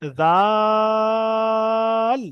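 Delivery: দাল held very long; long drawn dal. Shown as a demonstration. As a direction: stretch দাল slowly. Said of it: "তারপরেরটাও তাই".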